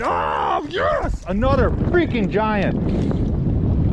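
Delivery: real triumphant yell; excited shout. Real, not acted. Angler celebrating a double hookup, real excited shout. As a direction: let out a burst of joy. Second big fish landed.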